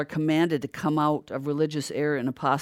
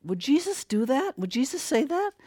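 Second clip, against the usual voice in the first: questioning; 8 semitones higher, 3 dB quieter than usual. Retold or imitated. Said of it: "I'm like".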